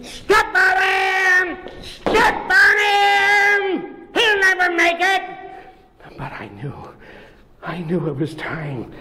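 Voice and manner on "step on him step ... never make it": a nasty rat jeering high; rat yelling. Acted. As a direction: egg the chasing crowd on. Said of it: "that rat yell at me".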